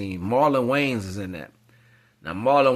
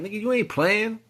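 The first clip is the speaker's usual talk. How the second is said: much higher, mocking threat; tough guy quote; 10 semitones higher than usual. [name] brushing off [name], high mocking threat. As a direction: sneer it like a street tough warning him off. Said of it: "He's like".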